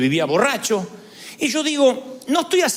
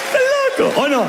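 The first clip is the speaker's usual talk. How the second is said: high, fast, mocking disbelief; incredulous jab; faster than usual. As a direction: ridicule the three-pot mothers. Se burla de las tres ollas. (burla incrédula, aguda y rápida)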